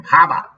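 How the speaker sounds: Haba said for Harbaugh, the r dropped, HAH-buh; mock Haba pronunciation. Shown as a demonstration. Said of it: "can't say Harbor or Harbaugh without them sounding the same".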